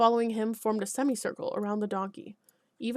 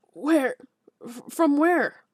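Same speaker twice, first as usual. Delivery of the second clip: stunned; 4 semitones higher, 6 dB louder, much slower than usual. Acted. Alderman stunned.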